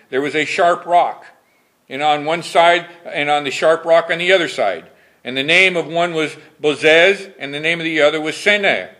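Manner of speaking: solemn pulpit reading of scripture. Acted. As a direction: read the King James verses with solemn weight. Preacher reads scripture aloud.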